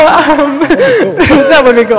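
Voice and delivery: slow words through a laugh; giggling it out slowly. Real, not acted. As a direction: let the laugh carry it. Laughing at own joke.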